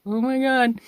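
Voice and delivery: high, loud Oh my God; shocked gasp. Retold or imitated. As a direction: react as if horrified. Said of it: "I'd be like".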